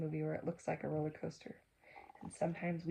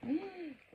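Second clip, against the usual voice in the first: gasp; 11 semitones higher than usual. Acted. Delighted gasp at train.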